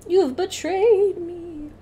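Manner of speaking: very slow, high mock lament; melodramatic, drawn-out accusation; mock betrayal. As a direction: stretch out "betrayed" in hammed-up heartbreak. Mock dramatic reaction to game.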